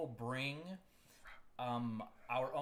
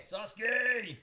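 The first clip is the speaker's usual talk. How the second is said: excited shout; 11 semitones higher, 10 dB louder than usual. Pretend. Shouting the anime name.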